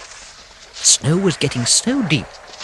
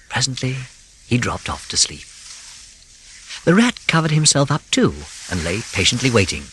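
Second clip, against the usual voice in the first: usual speaking voice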